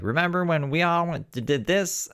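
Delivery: imitating friend. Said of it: "she'll be like".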